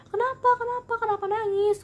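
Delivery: put-on a grandma rushing to a crying child; worried grandma. Grandmother asking the crying granddaughter what happened, high and anxious. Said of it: "kata gitu".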